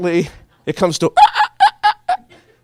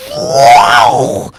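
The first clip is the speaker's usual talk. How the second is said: high, loud breathy wow; teasing the kid with fake astonishment; mock amazed wow; 11 dB louder than usual. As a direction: gasp out a wow of fake awe. Mock awe.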